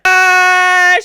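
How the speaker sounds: loud, high "Ah"; short shriek of shock; startled scream. Real, not acted. Viewer startled as she walks through a chair, startled scream.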